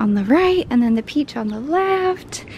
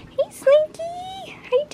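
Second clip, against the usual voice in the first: very high, slow sing-song greeting; pet-talk voice; slower than usual. Real, not acted. Owner greeting her dog Slinky, high pet-talk voice. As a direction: coo high at a beloved dog.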